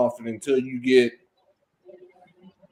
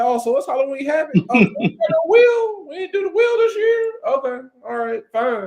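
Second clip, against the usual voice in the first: much higher mock-indignant voice; sarcastic mock complaint; 10 semitones higher, faster than usual. Complaining to the fans about the missing wheel, mock-indignant. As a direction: whine it in a high sarcastic pitch. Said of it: "I'm like".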